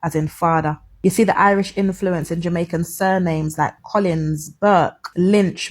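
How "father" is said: "father" with D in the middle in place of TH. Shown as a demonstration. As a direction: say "father" as fah-da. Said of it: "T H in the middle of the words as d".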